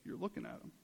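low, soft understated reply; Jesus. Retold or imitated. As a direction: drop to a quiet, offhand answer. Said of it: "And Jesus is like".

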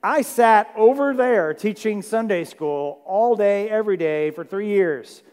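loud, exasperated rant at the mob, slowing on Sunday school; yelling at the crowd. Retold or imitated. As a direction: scold the crowd like a fed-up teacher. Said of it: "starts yelling at the crowd".

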